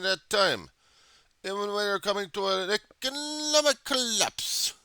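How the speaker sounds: high, loud, slow hammed-up Russian accent; mock Russian accent. Soviet comrade justifying the Afghanistan invasion, in a loud, slow mock Russian accent. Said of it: "false Russian accent".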